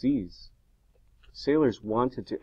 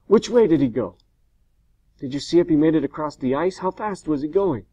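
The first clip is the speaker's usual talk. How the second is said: higher, louder urgent questioning; urgent yell; 6 semitones higher, 7 dB louder than usual. A narrator's reading. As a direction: fire off the questions in a raised voice. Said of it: "Frankenstein yelled".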